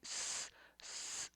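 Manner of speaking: "स" as a bare, voiceless "sss"; S sound. Shown as a demonstration. Teacher showing learners the double-S ending, "स" as a pure hiss. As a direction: hiss "स" softly with no vowel after it. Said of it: "स, double एस".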